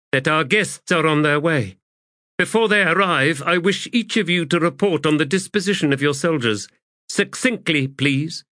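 high-pitched, crisp officer issuing orders; commanding adjunct. Acted. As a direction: take control of the briefing. Voices the adjunct giving orders.

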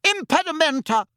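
high-pitched yell of a spell. A narrator's reading. Bystander yelling a spell to break up a fight, yelled. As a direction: cast it with a high, urgent cry. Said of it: "somebody in the vicinity yelled".

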